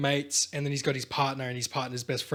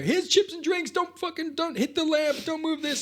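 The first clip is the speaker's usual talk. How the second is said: high-pitched fussy host giving orders; shrill-high mock Martin patrolling the room; bossy host; 13 semitones higher than usual. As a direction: squeak out house rules like an anxious host. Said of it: "Martin's just like".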